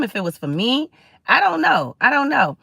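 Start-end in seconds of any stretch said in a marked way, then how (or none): 1.3-2.6 exasperated unsure. Real, not acted. Emphatic uncertainty repeated.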